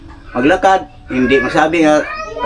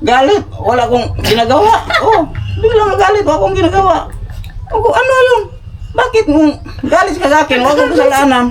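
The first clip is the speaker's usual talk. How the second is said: high-pitched, animated protest; real indignant outburst, voice way up; over-the-top reaction; 10 semitones higher than usual. Real, not acted. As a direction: protest your innocence in a high, worked-up voice. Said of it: "Grabe makareact".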